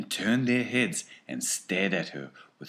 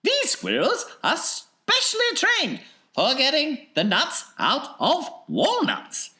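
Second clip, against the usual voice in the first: high, lively showman voice; Wonka's high, bouncy showman voice; eccentric chocolatier; 8 dB louder than usual. Acted. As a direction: play the excitable factory owner, pitched well up. Wonka explaining.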